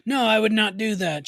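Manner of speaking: fake innocent denial.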